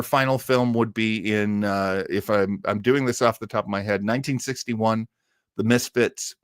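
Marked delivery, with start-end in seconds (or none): none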